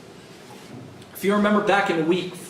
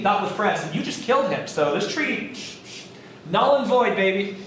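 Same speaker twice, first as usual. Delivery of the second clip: higher, smug mock Washington; 8 semitones higher than usual. Teacher playing Washington gloating to France about the void treaty, higher and smug. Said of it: "And Washington was like".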